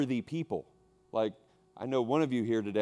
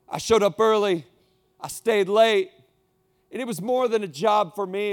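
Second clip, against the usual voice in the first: higher, louder aggrieved plea; hurt devoted worker; 8 semitones higher, 6 dB louder than usual. Acted. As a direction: plead your sacrifice, rising. Preacher voicing a worn-out worker.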